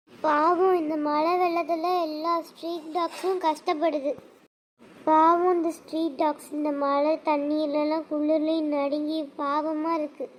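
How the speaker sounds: soft, slow and low, full of pity. Acted. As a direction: hush down, feel sorry for the puppies. Pitying street dogs in flood. (மெதுவான, இரக்கமான தாழ்ந்த குரல்)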